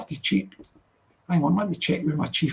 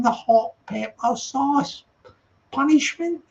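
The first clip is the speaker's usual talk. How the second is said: loud, raised mock-indignant address; mock confrontation; 5 semitones higher, 5 dB louder than usual. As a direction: call out the absent dad in playful disbelief. Mock address to an absent dad.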